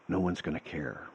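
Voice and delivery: soft, low-key weary verdict; quiet resigned. Retold or imitated. Mentor softly telling Rob no one will care. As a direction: let it drop softly, like bad news. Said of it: "he goes, just like this".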